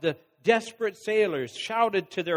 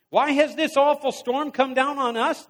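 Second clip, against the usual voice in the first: raised, higher demanding voice; 6 semitones higher than usual. A narrator's reading. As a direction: press him hard for an answer. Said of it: "they demanded".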